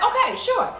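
high, loud, bright yes; eager agreement. Acted. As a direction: jump at the idea. Girl agrees to dog's idea.

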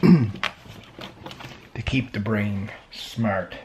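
ordinary talk, nothing marked

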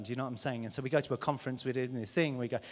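usual speaking voice

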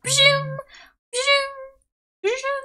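high, loud "pshum" sound effect; imitated alert noise. Streamer imitates her flood of alerts, high noise. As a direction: mimic repeated high whooshing alert pings. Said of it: "it was literally like".

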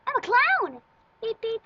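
high, loud clown shout; silly clown. Pretend. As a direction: announce yourself as a goofy clown. Playing a clown.

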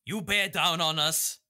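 ordinary delivery

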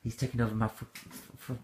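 trailing off, stammering breathless; faltering. Real, not acted. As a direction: lose the words in fits. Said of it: "It's trying to affect you now".

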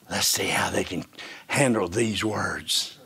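low, soft whispered aside; hushed scheming. Acted. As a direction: whisper it like a sly plot. Priest's scheming attitude voiced.

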